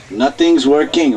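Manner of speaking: normal talking voice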